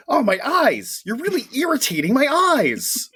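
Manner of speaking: high-pitched mock wail; mock agonized villain. Quoting Stiltman with wings in his face, mock wail. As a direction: wail it like a hammed-up comic villain. Acted quote of villain in distress.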